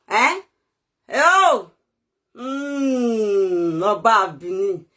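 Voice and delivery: low, slow, crying address; lamenting to Oba Bini, low and crying; mournful lament. Real, not acted. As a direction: moan the name in grief.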